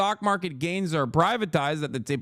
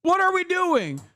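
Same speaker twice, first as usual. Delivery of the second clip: high voice, sarcastic praise then panic; hammed-up fawning crowd; mock sycophants turning desperate; 11 semitones higher than usual. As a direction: gush then cry out, high. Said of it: "everyone's like".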